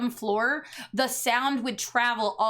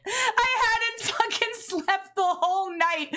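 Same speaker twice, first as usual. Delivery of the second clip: laughing; 9 semitones higher than usual. Real, not acted. Laughing, recalling exhaustion.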